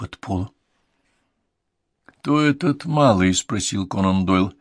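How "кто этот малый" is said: curious question. Acted. Conan Doyle asks.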